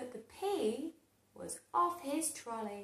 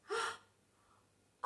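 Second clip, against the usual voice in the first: high gasp; 11 semitones higher, 4 dB quieter than usual. Acted. Vegetables gasp.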